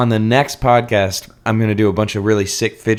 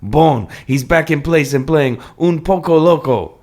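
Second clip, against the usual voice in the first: put-on hammy Cajun French accent; 4 semitones higher than usual. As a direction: play a nervous Cajun Frenchman. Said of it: "my incredibly accurate French accent".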